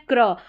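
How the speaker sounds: क्र as one tight consonant cluster. Shown as a demonstration. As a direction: say क्र with no vowel between k and r. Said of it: "C R को क्र पढ़ना है". (क्र को जोड़कर बोलें)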